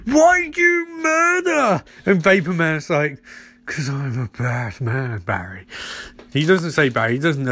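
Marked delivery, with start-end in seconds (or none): 0.1-1.8 high, slow hammed-up hero voice; mock hero demand. Barry confronts the Vapor killer, high and slow. 3.7-5.7 low, soft and slow villain growl; mock villain. The Vapor tells Barry he is bad, low and slow.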